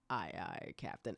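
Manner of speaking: low, quiet voice-actor impression; Tony J. AI house voice answering its owner, low gentlemanly impression. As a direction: drop deep and soft for a gentlemanly reply. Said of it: "And his voice would come on".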